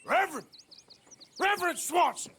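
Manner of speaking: high, loud searching call. Acted. Man searching for the wandering reverend, calling out. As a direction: holler the name to find someone out of sight.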